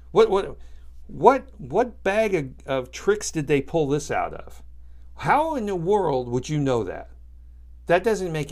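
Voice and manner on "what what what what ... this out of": sputtering with real outrage; angry outburst. Real, not acted. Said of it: "those two last two stories made me so angry".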